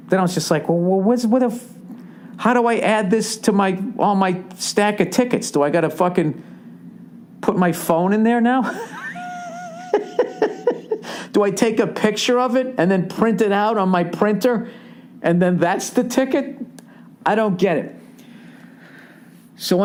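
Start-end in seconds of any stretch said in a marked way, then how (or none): 0.7-8.6 high-pitched, baffled questioning; mock bewilderment. Old tech-clueless man asking about his ticket, bewildered. 8.7-11.3 high, squealing wheeze of laughter; wheezing laugh. Real, not acted. Comic cracking up at his own phone confusion, wheezy laugh. 11.3-17.9 high, confused questioning; mock bewilderment. Old man lost over printing his ticket, bewildered. Resumes baffled rant after laughing.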